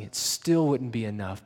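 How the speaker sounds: normal talking voice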